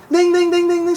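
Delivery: high, loud ding ding like a ringing bell; bell imitation. Imitating the tiki bar bell ringing, sing-song.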